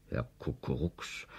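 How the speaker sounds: ordinary delivery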